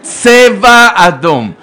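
slow, loud, drawn-out alarm call; siren announcement. Retold or imitated. Imagined rocket siren in his head, slow loud alarm call. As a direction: stretch every word like a siren warning. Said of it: "שמעתי אזעקה".

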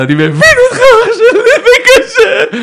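high, loud, fake-crying sympathy; mock sob. Preacher comforting the husband with a hammed-up sob. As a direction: wail it through fake tears.

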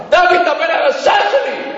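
high, accusing cry; angry reproach. Retold or imitated. Father to his wayward son, angry reproach. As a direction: throw it as a bitter accusation.

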